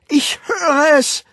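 very high, loud, drawn-out shout; high wailing exclamation; ecstatic cry. A narrator's reading. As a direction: call it out in frenzied revelation. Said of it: "rief sie".

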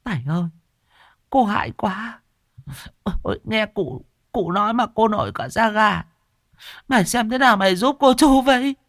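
high, whining frightened wail; an old aunt begging for help, close to tears; whimpering old woman. Acted. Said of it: "bà Kiểm mếu máo". (bà già mếu máo, giọng cao rên rỉ)

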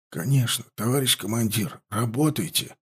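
soft, weak half-whisper. Acted. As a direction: barely voice the words, too ill to speak up. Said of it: "прошептал боец".

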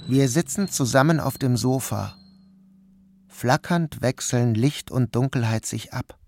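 usual speaking voice

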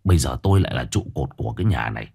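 man's voice gentled, low and soft; softened voice. A narrator's reading. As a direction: soothe her in a low voice. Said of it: "ông Cửu dịu giọng".

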